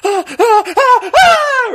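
put-on high loud screaming girl voice; terrified little girl. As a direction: shriek like a frightened girl. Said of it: "my impression of a little girl terrified".

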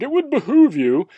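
high, loud mock-haughty lord; mock medieval lord. A lord mocking his serf, hammed up and high. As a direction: lord lording it over a peasant. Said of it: "and they were like".

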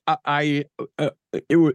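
mock flustered stammer; a retold, nervous stammer; stumbling. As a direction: stumble over the words as if caught off guard. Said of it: "sort of stumbly".

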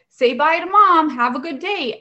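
lightly imitating a bright teacher voice; chirpy bye, mildly played; breezy mock chirp. Mom retells the breezy dismissal.

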